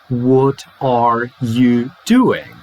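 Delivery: slow "What are you doing?", ARE and YOU in full; unreduced full form. Shown as a demonstration. Teacher shows what isn't really said, slow full form. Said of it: "It's not actually".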